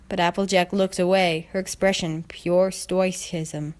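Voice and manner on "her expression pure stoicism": usual speaking voice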